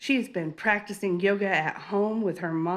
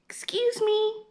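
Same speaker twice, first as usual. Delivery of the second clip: much higher and slower, a tiny mouse voice; soft mouse voice; 13 semitones higher than usual. A narrator's reading. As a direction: speak softly as a small mouse. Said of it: "she heard a soft voice say".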